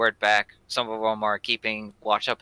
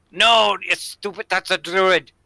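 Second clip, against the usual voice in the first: loud bandit voice; 8 semitones higher, 8 dB louder than usual. Acted.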